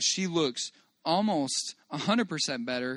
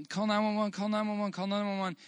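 higher, urgent mock shout; retold yelling; 6 semitones higher than usual. Dad retelling his yell at the crowd, higher mock shout. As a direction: repeat it as an urgent call for help. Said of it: "so I yell".

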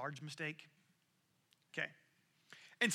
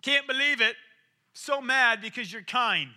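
hammed-up indignant, louder and higher; mock mad Jonah; 4 semitones higher, 8 dB louder than usual. Jonah fuming at God's kindness, hammed-up anger. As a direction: play the sulky prophet fuming. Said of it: "he's like".

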